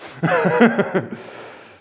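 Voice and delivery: high, loud burst of laughter. Real, not acted. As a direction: laugh out at the interruption. Laughs when someone interrupts.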